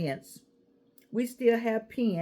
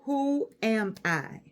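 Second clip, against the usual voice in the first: slow, deliberate question; slow dictation; much slower than usual. Shown as a demonstration. Teacher showing the audience what to write, slow. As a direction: draw the question out slowly. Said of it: "That's on the paper".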